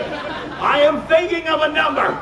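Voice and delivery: high, slow, drawn-out announcement; grand proclamation. Acted. Host starting the mind-reading trick, grand proclamation. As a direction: proclaim it to the whole room, stretching the words.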